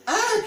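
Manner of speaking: high loud startled shriek; loud farmer's shriek of alarm. A narrator's reading. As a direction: shriek in fright. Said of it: "shrieked the farmer".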